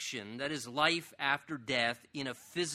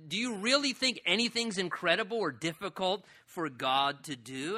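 ordinary talk, nothing marked

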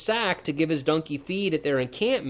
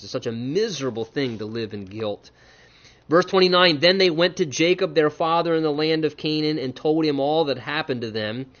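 ordinary talk, nothing marked

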